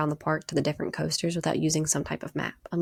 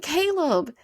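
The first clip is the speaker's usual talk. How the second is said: exasperated name call; 9 semitones higher, 4 dB louder than usual. Retold or imitated. Calling brother out in disbelief.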